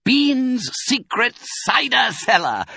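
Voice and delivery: high, slow, savoured announcement; triumphant reveal. A narrator's reading. Mister Fox names the secret cellar, triumphant. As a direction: unveil it word by word.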